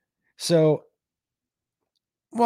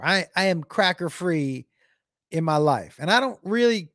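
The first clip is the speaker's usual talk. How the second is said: normal talking voice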